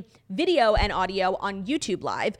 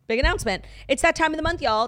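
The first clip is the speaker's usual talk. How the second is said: excited announcement; 7 semitones higher, 4 dB louder than usual. Real, not acted. Announcing to listeners.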